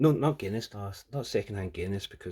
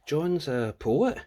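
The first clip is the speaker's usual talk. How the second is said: slow, grand, louder recital; dramatic poetry reading; 2 semitones higher, 6 dB louder, slower than usual. Shown as a demonstration. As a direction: read it like a solemn poem. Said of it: "That's poetry".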